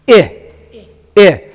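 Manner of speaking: "I" said as the short vowel ih; isolated, punchy ih for "I"; short I demo. Shown as a demonstration. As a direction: sound out "I" as in hit, twice with a pause. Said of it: "sounds like a short I".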